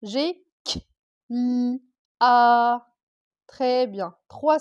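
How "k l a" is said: k, l, a one sound at a time; short k, then held l and a; separate sounds. Shown as a demonstration. Teacher counting sounds in a syllable, each sound separate. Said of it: "combien de sons j'ai dans cla".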